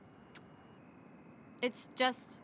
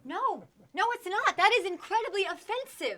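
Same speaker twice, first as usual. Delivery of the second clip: indignant; 9 semitones higher, 4 dB louder than usual. Acted. Daughter objects to the scheme.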